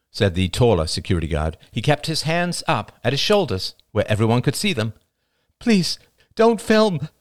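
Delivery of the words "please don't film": high, slow, strained plea; distressed, pitched-up begging; weak cry. A narrator's reading. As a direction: plead weakly in a raised pitch. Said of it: "cried Rachel weakly".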